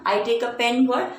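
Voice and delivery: ordinary delivery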